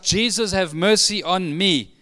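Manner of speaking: crying out. Acted. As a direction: call it out as an acted cry of faith. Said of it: "rises up and cries out".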